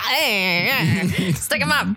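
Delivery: put-on old-time gangster voice; 1920s gangster. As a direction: play a hammy 1920s gangster. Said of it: "he's not from the nineteen twenties".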